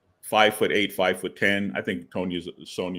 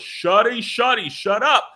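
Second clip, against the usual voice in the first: high, loud, repeated shushing as the squire; kid hushing sharply; 9 semitones higher, 5 dB louder, slower than usual. Retold or imitated. Squire to his screaming heroine, high and insistent scolding. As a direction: act out a boy urgently shutting someone up. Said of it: "And the kid goes".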